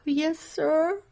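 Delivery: high, loud, choked begging; tearful pleading. Retold or imitated. Preacher answering God in the vision, weeping. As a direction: beg through tears. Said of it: "I go".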